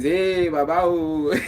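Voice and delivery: a hint of a sweet-talking fan, high and loud; butter up a rich man with a cheerful hail; lightly voiced praise-singer hailing a rich man; flattering greeting. Shown as a demonstration. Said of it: "you do like this".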